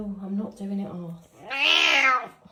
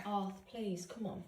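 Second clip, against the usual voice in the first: soft pleading; 8 dB quieter, slower than usual. Real, not acted. Softly pleading with dog.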